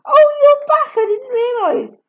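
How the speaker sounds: high, loud mock astonishment; pretended surprise. Katie feigning surprise at her sister, high and loud. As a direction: fake being startled. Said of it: "in pretended surprise".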